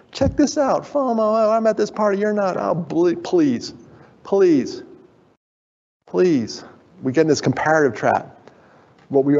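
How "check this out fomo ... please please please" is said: 1 mock show-off poster. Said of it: "everybody's highlight reel".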